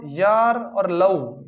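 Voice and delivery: यार और लव stretched very slowly; slow mnemonic. Shown as a demonstration. Teacher giving students a memory trick, very slowly. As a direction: draw out यार और लव. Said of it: "याद करने का तरीका बता देता हूँ".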